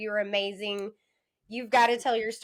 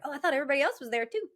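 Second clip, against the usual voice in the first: innocent surprise; 7 semitones higher, much faster than usual. Retold or imitated. Retold naive self.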